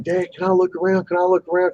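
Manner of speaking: imitating his kid. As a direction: voice the boy begging to explore. Quoting his young son.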